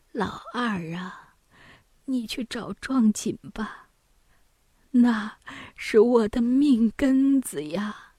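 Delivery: faint, whispery start, slow drawn-out plea; feeble mother pleading. Acted. Bedridden mother begs second son for the brocade, feebly pleading. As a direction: plead weakly from a sickbed, dragging the words.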